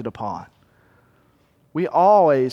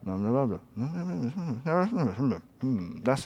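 low, indistinct mumble under the breath; muttering; 4 semitones lower, 10 dB quieter than usual. Acted. Preacher imitating a man going around muttering, low murmur. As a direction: mumble quietly to yourself. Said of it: "a guy that's murmuring or muttering".

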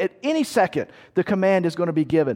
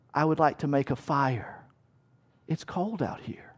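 quiet aside; 4 semitones lower, 8 dB quieter than usual. Retold or imitated. Soldier mimes wanting a fire.

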